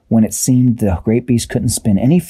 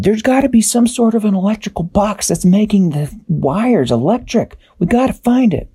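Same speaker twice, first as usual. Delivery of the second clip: high, bright little character voice; Tweak's voice; 12 semitones higher than usual. A narrator's reading. Tweak to crew, high worried character voice. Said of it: "Tweak looked around and said".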